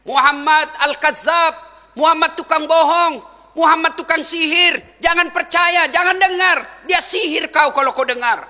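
loud, high heckler's cry; shouted accusations. Retold or imitated. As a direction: shout warnings to a crowd, denouncing. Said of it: "Apa teriaknya?".

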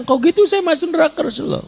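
high, quick, imitated anxious question; sensitive worrier. Abu Bakar asking the Prophet in alarm, high and sensitive. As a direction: blurt it out high, mock-distressed. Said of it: "dengan sensitivitas saya bilang".

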